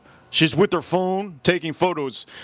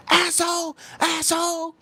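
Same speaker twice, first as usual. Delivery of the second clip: high-pitched mock scream; retold scream; 14 semitones higher than usual. Woman heard screaming from her car, high mock yell. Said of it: "She started screaming".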